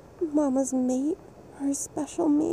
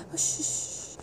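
hushed whispered shh, barely there; soft shushing; 8 dB quieter than usual. Acted. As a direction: shush him gently like calming a child. Soothing a partner in roleplay.

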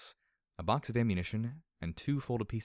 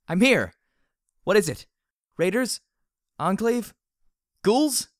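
high, loud yelled questions; alarmed shouting; 13 semitones higher, 12 dB louder, slower than usual. A narrator's reading. Heroine rushes out guessing at enemies, shouting in alarm. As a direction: shout out each urgent question separately. Rushing out to answer frantic call.

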